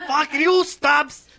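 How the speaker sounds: laughing fake-angry shout, high and loud; mock angry yell. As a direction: shout it while cracking up. Repeating the angry bit laughing.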